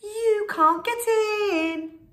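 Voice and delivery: high slow teasing taunt; high loud sing-song taunt. A narrator's reading. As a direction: tease the wolf. Pigs taunt wolf.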